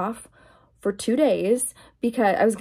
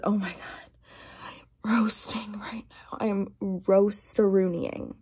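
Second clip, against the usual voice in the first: whisper; 4 dB quieter than usual. Real, not acted. Vlogger whispering to viewers that she is roasting. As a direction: whisper it as if too hot to speak up.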